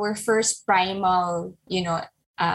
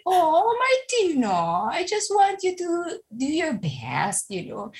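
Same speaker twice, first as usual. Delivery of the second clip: softened; 7 semitones higher than usual. Retold or imitated. Mom softening toward crying daughter.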